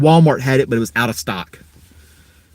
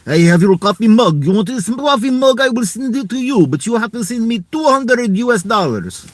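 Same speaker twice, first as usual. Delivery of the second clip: hammed-up Russian accent, higher pitch; 6 semitones higher than usual. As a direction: play a jokey Russian mug seller in a thick Russian accent. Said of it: "You do not like my Russian accent".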